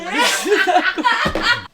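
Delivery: very high, loud, slow screech; comic over-the-top shriek; hammed-up squawk. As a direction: screech the turf claim for laughs. [name]'s take in the turf quarrel.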